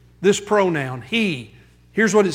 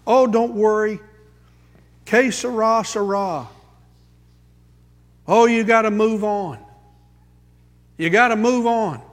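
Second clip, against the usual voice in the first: breezy brush-off; 4 semitones higher than usual. Retold or imitated. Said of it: "what do we say to the sad person".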